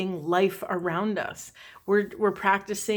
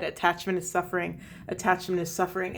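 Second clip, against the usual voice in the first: quick, softer repeated mantra; retelling a phrase looped in her head, quick and soft; rattled mantra; 5 dB quieter, faster than usual. Retold or imitated. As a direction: rattle it off under your breath. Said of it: "rattling that over and over in my head".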